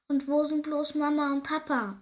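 a hint of a child wondering aloud; wondering baby hare. A narrator's reading. Baby hare looking for parents, lightly voiced wondering. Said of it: "überlegt Konstantin".

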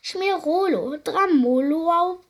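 high, loud overacted pain cry; crowing creature. A narrator's reading. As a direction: ham up the fake pain. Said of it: "krähte es". (hohes, krähendes, übertriebenes Jammern)

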